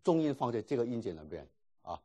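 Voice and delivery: normal talking voice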